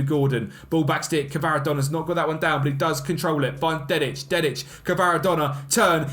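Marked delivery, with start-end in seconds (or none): none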